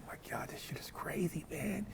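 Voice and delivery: hushed whisper to himself; stunned whisper in a prison cell. Retold or imitated. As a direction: breathe it out under his breath. Prison story, inner thought whispered.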